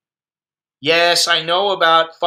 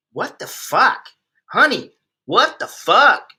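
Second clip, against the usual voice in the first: exasperated disbelief; 6 semitones higher, slower than usual. Pretend. Staring at the glue woman, a slow stunned "what the fuck". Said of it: "just go".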